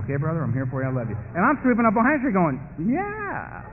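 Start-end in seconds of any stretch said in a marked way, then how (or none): 0.1-1.2 lightly voiced, lowered reassurance; low, smooth tempter; coaxing beast. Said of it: "the beast whisper up and say". 2.8-3.6 high-pitched, drawn-out yeah; gullible, high yes; eager agreement. Acted. Himself eagerly agreeing with the beast.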